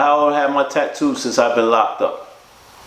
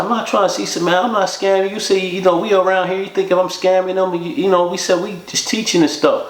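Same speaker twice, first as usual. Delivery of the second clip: higher, rushed, protesting voice; defensive denial; 8 semitones higher than usual. Retold or imitated. Mike denies scamming the narrator, high and defensive. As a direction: protest your innocence, half-pleading.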